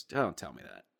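low, quick, deadpan groan of disbelief; weary front desk guy. Retold or imitated. Front desk guy to the kid who threw the bucket, low deadpan dismay. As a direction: sigh it out like he's seen it all. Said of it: "And he goes".

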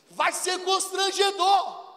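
high, loud, slow protest; Marta objecting. Acted. Marta warning Jesus at the tomb, high and drawn out. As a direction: plead against the embarrassment.

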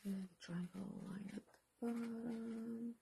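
slow soft whisper. Real, not acted. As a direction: murmur it slowly to yourself. Whispered while looking.

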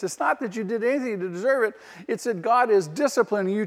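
ordinary talk

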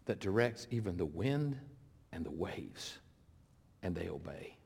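low, soft reading with long pauses; hushed awe. Acted. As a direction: let it sink almost to nothing by the end. Awed disciples in scripture reading.

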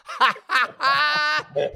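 high, loud real cackling laugh; loud burst of laughter. Real, not acted. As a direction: crack up helplessly at the answer. Cracks up at the Barbie Jeep answer.

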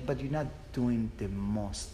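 normal talking voice

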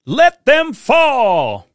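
slow, drawn-out, high booming shout; dramatic title shout. Shown as a demonstration. Host announcing the episode title as a slow shout. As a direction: proclaim the title like a battle cry. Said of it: "what does that title mean".

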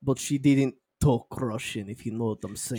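dry fake Russian delivery; mock Russian accent. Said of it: "she was Russian".

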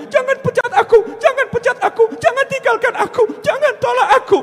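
very high, loud mock pleading; desperate begging. Servant begging people not to fire him, high hammed-up plea. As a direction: wail it like a hammed-up beggar. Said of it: "maunya ngemis sama manusia".

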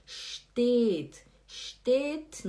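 steht with a drawn-out e; slow steht, long e before the t; long vowel. Shown as a demonstration. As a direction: hold the vowel in "steht": shteeet. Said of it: "a vogal é longa".

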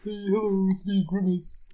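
high-pitched cartoon creature voice; a lighter, high mascot greeting; Grimace. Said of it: "What do you imagine Grimace sounding like".

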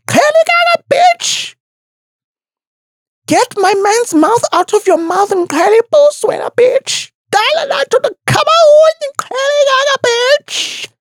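put-on very high, fast furious screech in a thick Xhosa accent; Mihlali. Imagined Mihlali beating someone up in the office, high angry screech. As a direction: imitate a woman screeching mid-brawl. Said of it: "sounds when she's fucking pissed off and angry".

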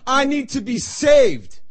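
loud, high, drawn-out plea; slow desperate cry for rescue, acted. Preacher voicing a drowning person begging for rescue, crying out. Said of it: "where you cry out".

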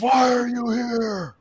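high, drawn-out monster yell; mock yell. Enraged minotaur yelling in his face, long high yell. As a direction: imitate a beast yelling, stretching the words.

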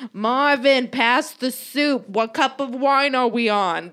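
hammed-up grandma hollering at Marvin.

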